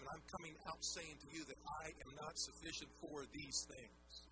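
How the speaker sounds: normal talking voice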